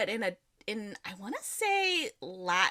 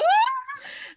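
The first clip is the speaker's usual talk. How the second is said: high, loud squeaky gasp; re-enacted high-pitched gasp of excitement; thrilled gasp; 6 dB louder than usual. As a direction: gasp in starstruck delight. Re-enacts joy at author agreeing.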